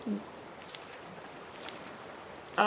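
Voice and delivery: ordinary talk, nothing marked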